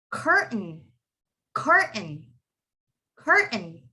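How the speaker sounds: "curtain" as cur, catch, "n"; silent T. Shown as a demonstration. Teacher models curtain for students, silent T. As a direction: swallow the T in "curtain". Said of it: "Can you guys see that?".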